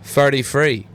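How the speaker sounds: "Thirty-three" with F in place of each TH; mock English accent, "Thirty-three" with F sounds; English TH-fronting. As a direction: mimic an English "furty-free" for "Thirty-three". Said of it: "It'd be like".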